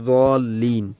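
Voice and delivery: "দলিন" begun midway between z and d; in-between pronunciation. Shown as a demonstration. Teacher modelling the middle way, in-between pronunciation. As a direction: say "দলিন" with its first sound blending d and z. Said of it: "মাঝামাঝি পড়বো".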